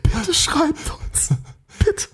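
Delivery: put-on high, whiny begging child voice; pleading like a little kid. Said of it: "Klingt beide gerade ein bisschen bettelnd".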